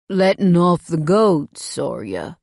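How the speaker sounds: low, slow southern stranger; lightly voiced drawling stranger; a hint of a slow southern giant; stranger's deep drawl. A narrator's reading. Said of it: "His voice is deep".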